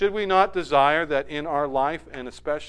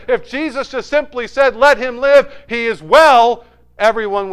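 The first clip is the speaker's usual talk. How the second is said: full preaching voice; 8 semitones higher, 12 dB louder than usual. Acted. Preacher urging the congregation toward God's presence, preaching at full force. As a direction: raise the pitch and pour it out to the congregation. Preacher building on Lazarus story.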